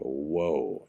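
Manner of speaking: low, soft drawn-out reaction; stunned. Retold or imitated. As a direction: quietly take in a friend's horror. Stunned reply to nightmare.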